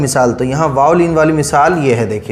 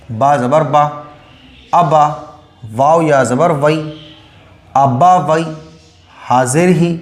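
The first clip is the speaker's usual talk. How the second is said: ordinary delivery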